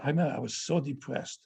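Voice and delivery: ordinary delivery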